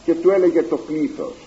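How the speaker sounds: ordinary delivery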